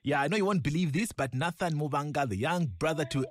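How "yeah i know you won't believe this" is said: usual speaking voice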